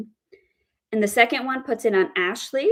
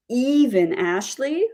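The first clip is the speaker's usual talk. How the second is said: EVEN drawn out slowly and raised; stress on EVEN; 3 semitones higher, 3 dB louder, slower than usual. Shown as a demonstration. Teacher explains the first meaning, stress on EVEN. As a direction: stretch EVEN, then rise. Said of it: "the first one is different because it means".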